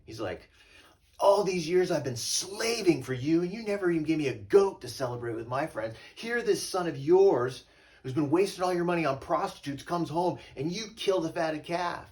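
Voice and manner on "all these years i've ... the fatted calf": much higher, much louder resentful outburst; ticked-off brother. Retold or imitated. Older brother protesting to his father, angry yelling. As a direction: hurl every grievance at his father. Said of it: "But he's ticked".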